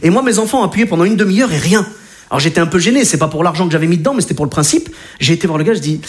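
ordinary talk

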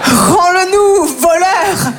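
crie lentement, plus haut et plus fort; cri de foule. Retold or imitated. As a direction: scander comme une foule qui exige son argent. Foule criant au voleur. (hurler lentement comme une foule en colère)

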